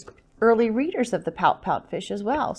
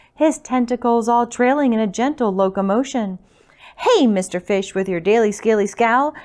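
lively, louder picture-book reading; story narration; 5 dB louder than usual. A narrator's reading. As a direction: read it out rhythmically to little listeners. Reads picture book to children.

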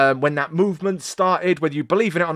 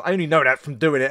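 ordinary talk

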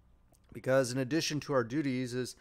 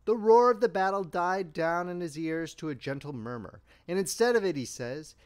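ordinary delivery

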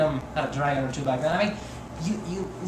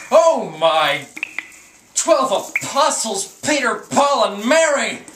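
shocked exclamation; 5 semitones higher, 6 dB louder than usual. Acted. Exclaims at hideous monster.

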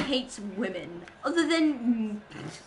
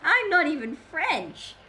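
slow, higher, swooping silly voice; put-on voice; 4 semitones higher, 4 dB louder than usual. Streamer repeating the joke to chat, in a put-on voice. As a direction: draw it out in a silly voice. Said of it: "why did I do that voice?".

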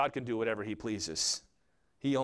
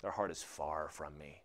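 low, much softer divine verdict; the Lord answering; 10 semitones lower, 10 dB quieter than usual. Acted. The Lord looks at them and answers, low and hushed. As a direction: drop low and quiet to pass judgement. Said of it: "The Lord looks at them and he says".

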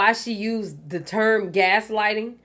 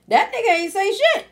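indignant; 12 semitones higher, 5 dB louder than usual. Real, not acted. Indignant recount of [name].

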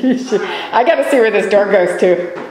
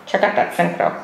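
talking on a held breath; words pushed out with breath held; holding breath; 3 semitones lower, 3 dB quieter than usual. Real, not acted. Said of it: "I'm holding my breath while I walk through here".

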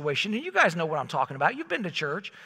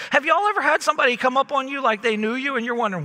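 ordinary talk, nothing marked

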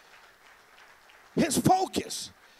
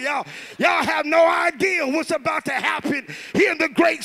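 fiery preacher rousing the crowd; preaching at full force; 2 semitones higher, 5 dB louder than usual. Real, not acted. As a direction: preach it loud and rising to the crowd.

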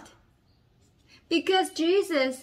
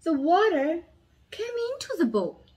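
dramatic storytelling. A narrator's reading. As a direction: lively voice for a children's Bible story.

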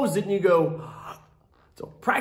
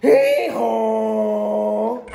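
donkey bray. Acted. Donkey bray in read-aloud.

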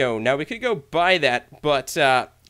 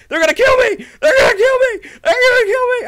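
mock panic; 9 dB louder than usual. Mobs chasing him in Nether.

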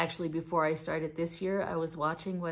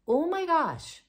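oh my gosh, much higher and louder; surprised gasp; 10 semitones higher, 8 dB louder, slower than usual. Real, not acted. Sees her new subscribers, real surprised outburst. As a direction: blurt it out in real shock at the numbers.

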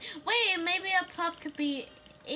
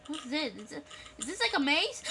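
ordinary talk, nothing marked

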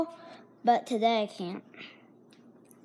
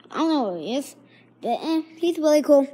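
ordinary delivery

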